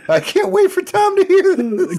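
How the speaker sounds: squealing with laughter, high and loud; gleeful laughing shriek. Retold or imitated. Laughing at the post.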